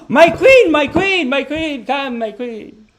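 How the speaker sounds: high, loud adoring "my queen" fading down; worshipping suitor. Retold or imitated. Suitor worshipping a woman, high adoring cries fading. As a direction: swoon over her like royalty.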